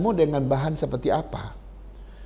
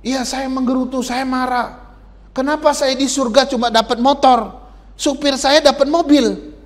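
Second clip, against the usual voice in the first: higher, complaining voice of a sulky mother; mother grumbling to the angel, higher and aggrieved; 8 semitones higher than usual. Retold or imitated. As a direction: whine about the unfair reward in heaven. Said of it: "Tahu nggak jawaban ibu tuh bilang?".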